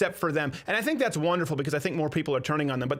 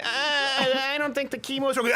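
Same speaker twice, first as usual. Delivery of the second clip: higher, put-on doctor voice; mock doctor; 7 semitones higher than usual. Imagining the doctor answering the patient, higher put-on voice. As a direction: play a baffled doctor, voice pitched up. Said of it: "can you imagine that doctor?".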